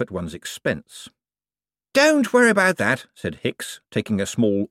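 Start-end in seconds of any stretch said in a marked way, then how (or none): 1.9-3.1 high, eager, bright-voiced wizard; a jumpy official covering for himself. A narrator's reading. Hicks reassuring about skulls.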